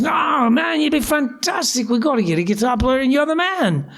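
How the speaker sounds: high-pitched, excitable drummer impression. Drummer insisting [name] join the band, high-pitched gushing imitation. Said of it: "he's this kind of guy".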